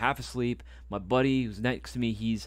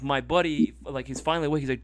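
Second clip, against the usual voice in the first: usual speaking voice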